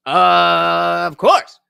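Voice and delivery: loud, very slow hype call, soaring high; drawn-out announcer. Pretend. Streamer crowning the new sponsor, loud drawn-out announcer call. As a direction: belt it out with long-held vowels.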